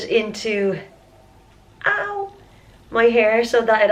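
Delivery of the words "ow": sharp ow. Real, not acted. Pain while touching crusted hair.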